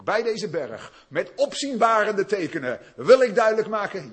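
loud, high, driving sermon voice; preaching at full force. Acted. Pastor stirring up the congregation, full-force preaching.

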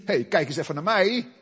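mock show-off. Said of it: "Het is niet".

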